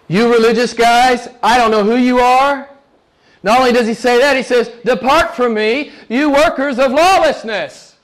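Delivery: forceful sermon condemnation; preaching rebuke. Real, not acted. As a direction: preach it as a judgment on the religious. Preacher voices divine rebuke.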